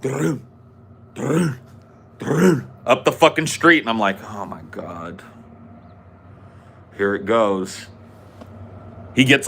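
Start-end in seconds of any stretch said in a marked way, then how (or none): 0.0-2.7 short rolled galloping noises; imitated horse hooves. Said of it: "I hear the sound of horse hooves". 4.2-7.9 soft, low, slow groan of doom; hushed dread. Retold or imitated. Retold dread as the father arrives.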